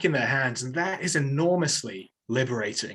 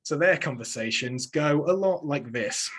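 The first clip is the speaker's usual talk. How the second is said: ordinary talk, nothing marked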